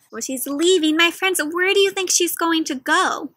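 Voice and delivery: ordinary talk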